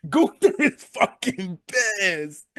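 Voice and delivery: high, drawn-out excited cry; losing it. Real, not acted. Fan telling how angry the wrestler was, high excited wail. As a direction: stretch it out high, overcome with excitement. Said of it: "I'm just losing it over here".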